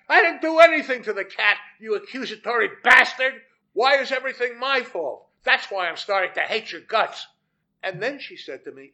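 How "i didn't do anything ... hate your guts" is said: high, loud and angry, easing toward the end; screaming back. A narrator's reading. Wife defending herself to her husband, angry scream back. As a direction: hurl it back as an accusation. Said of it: "And she screamed back".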